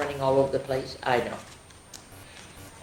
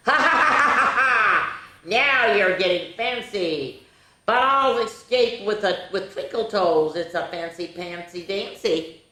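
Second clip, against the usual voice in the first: gloating leprechaun; 5 semitones higher, slower than usual. Acted. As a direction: taunt the trap-makers with a hammy laugh. Leprechaun laughs, reading aloud.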